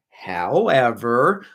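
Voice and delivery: a playful long "howeeever" before the point; slow drawn-out however. Real, not acted. Streamer teasing the chat with a slow, rising "however". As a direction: stretch "however" out slowly, rising at the end. Repeated word, second stretched.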